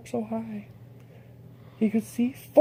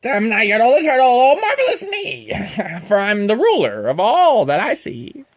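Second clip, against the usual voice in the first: boastful turtle king; 2 semitones higher, 6 dB louder than usual. Acted. Yertle the turtle king gloats over his kingdom, loud and proud. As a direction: crow over everything you rule like a puffed-up king.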